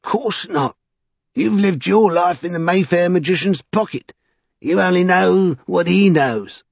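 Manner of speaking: high-pitched, mocking amusement; amused Vinculus. A narrator's reading. Vinculus taunting the magician's man, high and amused. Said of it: "Vinculus looked at him with amusement".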